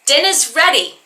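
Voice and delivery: put-on high, loud Boston-accented holler; mother's yell. Mother calling her husband to dinner from upstairs, yelling. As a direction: yell down the stairs, dropping the R's. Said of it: "hear my mother yell down the stairs".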